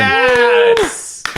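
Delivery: high, loud yes of triumph; cheer. Real, not acted. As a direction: celebrate the win out loud. Cheering double digits.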